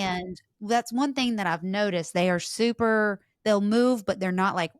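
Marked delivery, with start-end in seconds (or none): none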